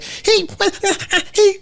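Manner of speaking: put-on high, upset kid whine; little kid mad. Girl mad about ice cream licks, high whining. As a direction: sputter like an angry toddler. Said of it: "like, little kid mad".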